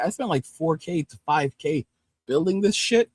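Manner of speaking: ordinary delivery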